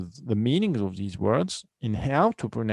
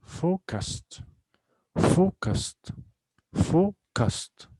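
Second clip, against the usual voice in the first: two separate syllables; 7 semitones higher, much slower than usual. Shown as a demonstration. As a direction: pause between "fo" and "cused" in "Focused". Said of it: "we have two syllables here".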